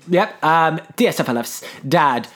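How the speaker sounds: normal talking voice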